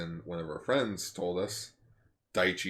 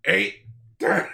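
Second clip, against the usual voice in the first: Earthworm Jim; 10 semitones higher, 8 dB louder than usual. Retold or imitated. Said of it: "Dan Castellaneta's Earthworm Jim".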